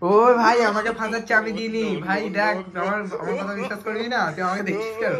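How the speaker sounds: panicked denial. Real, not acted. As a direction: plead innocence in a hurry. Denying blame to angry brother. (রাগী দাদার কাছে তাড়াহুড়ো করে দোষ অস্বীকার)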